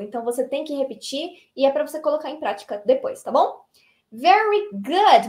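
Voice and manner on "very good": drawn-out cheerful very good; enthusiastic praise. Real, not acted. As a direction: praise the students warmly and slowly. Teacher praising class. (elogio animado e arrastado)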